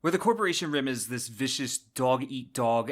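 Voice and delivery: usual speaking voice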